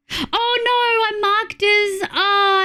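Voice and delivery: high-pitched whiny "oh no"; high, drawn-out dismay; dismayed wail. Real, not acted. Dismay at stream mishap.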